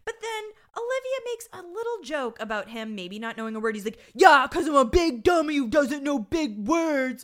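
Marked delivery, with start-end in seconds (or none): 4.1-7.2 loud, high, drawn-out retort; mock angry outburst. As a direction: hurl it sarcastically, slowly and loudly. Acted outburst of an offended character.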